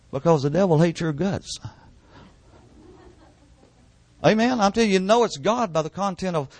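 high, driving preacher's voice; fiery preaching. Acted. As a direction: push it up like a revival preacher at full force. Preaching climax, pitch jumps.

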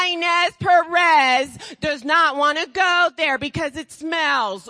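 loud, rising preacher's cry; full force preaching. Acted. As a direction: preach it out to the whole room.

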